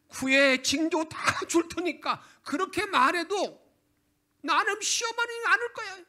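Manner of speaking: loud and high, slowed on the offer; preached loud quotes. Retold or imitated. Preacher voicing God and stubborn Ahaz, loud and high. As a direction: thunder out God's offer and the refusal.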